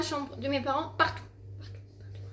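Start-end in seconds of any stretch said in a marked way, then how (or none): 1.6-2.2 whisper. Real, not acted. Frustrated search for lost phone.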